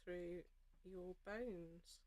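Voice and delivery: usual speaking voice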